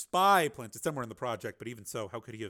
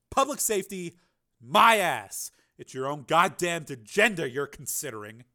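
scornful retort; 3 semitones higher, 8 dB louder than usual. Acted.

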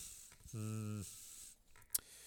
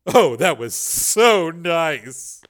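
satisfied sigh; 6 semitones higher, 11 dB louder, much slower than usual. Acted. Debbie sinking back satisfied.

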